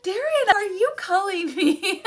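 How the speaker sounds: high, loud, playful outrage breaking into laughter; laughing mock outrage. Mom asking son about mommy monkey, laughing mock outrage.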